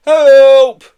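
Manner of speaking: very loud, high scream. A narrator's reading. Aardvark falling toward a reservoir, screaming. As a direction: scream for rescue. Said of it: "he screamed".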